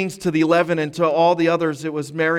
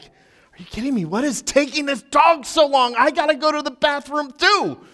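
impatient; 9 semitones higher than usual. Retold or imitated. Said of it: "eventually I was like".

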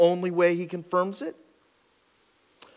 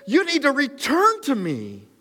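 higher, louder plea to return; God's call proclaimed; 12 semitones higher, 4 dB louder than usual. Acted. Pastor voicing God to the people, loud proclaiming. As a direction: call it out as the Lord's demand.

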